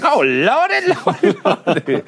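put-on high, slow, exclaiming like an old Southern lady.

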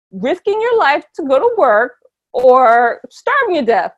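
ordinary talk

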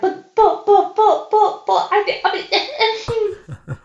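high, spluttering stammer; flustered protest. Retold or imitated. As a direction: splutter in disbelief at the cut-off. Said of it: "I was like".

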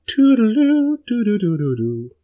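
high sung toodaloo then doo-doo; mouth mandolin. Acted. GM imitates Adeline's mandolin, sung toodaloo. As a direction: sing the mandolin tune with your mouth. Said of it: "can't make the sound of chords with my mouth".

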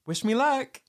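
high, bright-eyed wish me luck; chirpy quote. Retold or imitated. Andy leaving, hoping for luck, high and eager. Quoting Andy's cheerful line.

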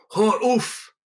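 furious bar owner roaring at the players. Acted. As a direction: bellow it like an angry giant. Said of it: "he roars".

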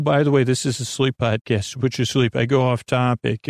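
ordinary talk, nothing marked

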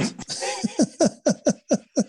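amused high-pitched laugh. Real, not acted. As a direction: burst out laughing. Laughs at own story.

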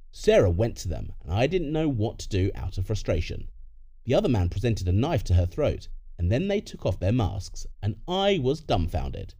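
plain reading voice

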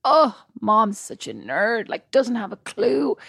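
put-on slow, sulky teen dismissing his mom; mock sulky son. Son mocking his mom to the family, slow and sulky. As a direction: drag it out like a kid rolling his eyes.

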